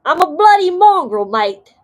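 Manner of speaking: loud, high mock-Australian accent; hammed-up Australian shout; attempted Australian accent. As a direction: belt it out in a badly faked Australian accent. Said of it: "I can't get that Australian".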